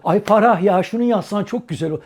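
loud, eager nudge; imagined friend urging. Retold or imitated. Friend egging Farah on, loud mock cheer. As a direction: cheer her on, mock-gossipy. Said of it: "Ya da şöyle canlanıyor kafamda".

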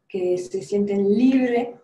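ordinary talk, nothing marked

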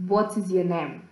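normal talking voice

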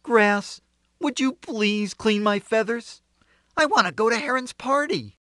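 high, polite rooster asking; pleading rooster. A narrator's reading. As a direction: ask sweetly in a high rooster voice. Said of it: "Rooster asked".

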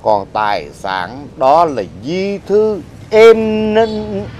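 normal talking voice

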